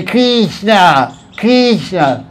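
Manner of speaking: "Krishna" with an Italian long ee; Italian accent. Retold or imitated. Showing how they chant in Italy, imitated Italian accent. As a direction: imitate an Italian saying "Krishna", first vowel stretched. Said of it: "And in Italy, they say".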